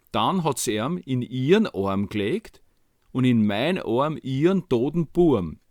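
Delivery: plain reading voice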